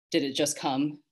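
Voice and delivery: plain reading voice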